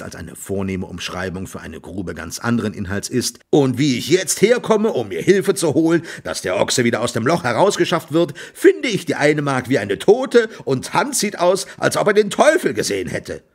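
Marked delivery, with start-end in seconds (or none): 3.5-13.4 higher, louder agitated complaint; worked-up farmer, pitch raised; exasperated rant. A narrator's reading. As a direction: carry on the tirade in exasperation. Exasperated farmer continues his rant.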